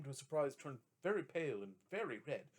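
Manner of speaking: ordinary delivery, nothing marked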